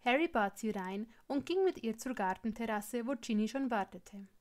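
ordinary delivery, nothing marked

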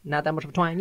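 light-twanged North Carolina talk; North Carolina accent. Shown as a demonstration. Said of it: "you can go North Carolina south".

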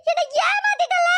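anguished wail. Acted. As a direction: wail it out in despair. Girl's despair peaks. (உடைந்த குரலில் அலறல்)

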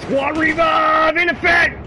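loud, drawn-out shout; shouted callout. Real, not acted. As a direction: call it out loud to the team. Calling out to squad.

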